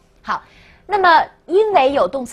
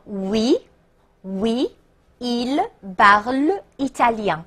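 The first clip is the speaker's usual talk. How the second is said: French answer in separate, drawn-out words; slow model answer; slower than usual. Shown as a demonstration. Teacher giving the answer after students try, slow model. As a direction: slowly sound out each word with pauses. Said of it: "请自己拼读吧".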